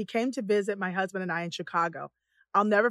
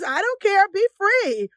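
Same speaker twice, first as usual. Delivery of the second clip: higher, louder carefree proclamation; emphatic retold exclamation; 9 semitones higher, 6 dB louder, slower than usual. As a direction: call it out freely, imitating someone. Husband's exclamation, acted out.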